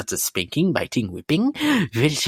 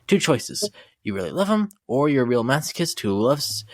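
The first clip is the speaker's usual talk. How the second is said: ordinary talk, nothing marked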